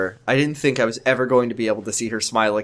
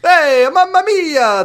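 slow, high hammed-up Italian exclamation; doctor exits with a hammy Italian cry; Italian accent; 14 semitones higher, 6 dB louder, much slower than usual. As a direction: mamma mia like a cartoon Italian. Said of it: "authentic Italian accent".

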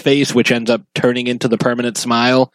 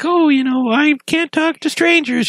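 put-on much higher, small child's voice; little Georgie. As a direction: pitch way up like a timid little kid. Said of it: "It was like Georgie's like".

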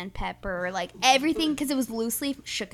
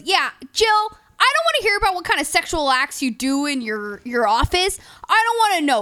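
loud, raised retelling of an outburst; mock exasperated rant; 5 semitones higher, 9 dB louder than usual. Dad telling off Jill in court, mock exasperated rant. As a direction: rail at her in hammed-up disgust.

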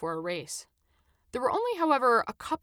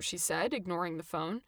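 ordinary delivery, nothing marked

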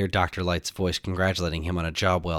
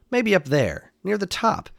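high-pitched musing hero; acted, higher-pitched discovery; hopeful idea; 11 semitones higher than usual. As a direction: lift the voice as if looking far up. Hero spots a possible exit.

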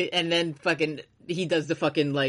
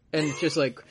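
usual speaking voice

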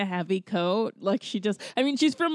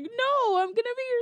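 much higher put-on voice; imitated film character; 13 semitones higher than usual. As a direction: pitch it right up for the character's reply. Voicing film character's reply.

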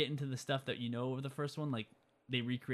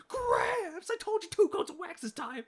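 much higher and louder, manic punk voice; squeaky mock Griff; 5 dB louder, slower than usual. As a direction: squeal it like a hyper teen thug. Griff yelling at grandpa Biff.

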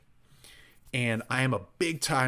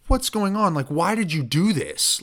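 higher, louder tearful protest, hammed up; mock sobbing; 7 semitones higher, 5 dB louder than usual. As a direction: imitate yourself wailing in upset disbelief. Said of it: "I just start sobbing".

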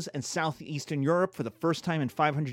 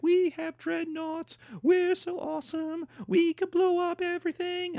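mock British boasting; 13 semitones higher than usual. Britain boasting of its battleships, high mock-grand falsetto. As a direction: crow it in a silly falsetto.

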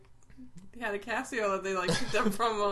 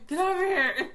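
mock mom scream; 8 semitones higher, 5 dB louder than usual. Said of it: "your mom starts screaming at you".

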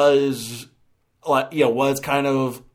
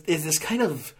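ordinary talk

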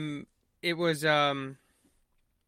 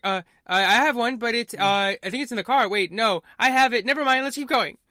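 higher, louder, flustered cover-up; flustered rambling; 6 semitones higher, 5 dB louder than usual. Retold or imitated. Groom stalling his fiancée before the proposal, flustered and higher. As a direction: babble past the awkward moment.

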